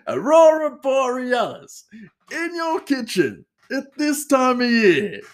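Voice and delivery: high, loud, slow incredulous boss voice; Chalmers impression. Superintendent doubting Skinner's excuse, hammed-up. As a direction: stretch it out in disbelief, mock outrage. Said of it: "And he's like".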